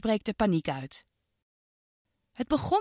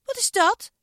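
high, louder startled question; startled girl; 13 semitones higher, 5 dB louder than usual. Acted. Girl hears giggling, high startled voice. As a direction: jump at a strange sound. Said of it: "vroeg Iris".

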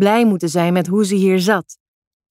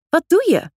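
sudden much higher outraged cry; cried out; 9 semitones higher than usual. A narrator's reading. Janna cries out as mother pauses her show, indignant. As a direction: yelp it as the show stops. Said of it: "riep Janna".

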